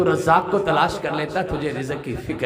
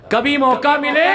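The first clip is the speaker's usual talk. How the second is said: preaching cry; 14 semitones higher, 7 dB louder than usual. Real, not acted. Preaching climax.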